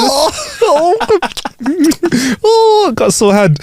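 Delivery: high, laughing complaint of a sore hand; laughing mock kangaroo. Host voicing the punched kangaroo, laughing high. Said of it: "voicing the sore kangaroo".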